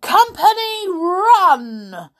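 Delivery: very high, loud military order; commanding cry. Acted. Colonel orders his herd to flee, loud commanding cry. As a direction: bellow the order to the whole herd. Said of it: "cried the Colonel".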